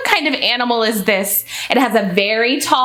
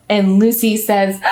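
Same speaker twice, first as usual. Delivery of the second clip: usual speaking voice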